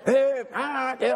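high chanted marching cadence; drill cadence call. Retold or imitated. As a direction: chant the count like a drill corporal. Corporal calling marching cadence.

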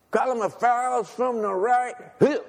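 high, slow lisping order; lisping drill command. Retold or imitated. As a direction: give the order like a lisping corporal. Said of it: "He had a lisp".

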